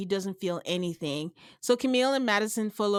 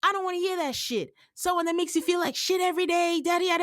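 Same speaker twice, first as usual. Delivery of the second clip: higher, mock yell; imitating a man yelling at her, pitched up; hammed-up retold shouting match; 7 semitones higher than usual. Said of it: "he starts yelling".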